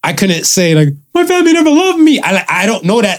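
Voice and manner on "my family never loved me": high, mocking whiny voice; mock whine. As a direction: whine it like a mock sob story. Mock hypothetical complaint.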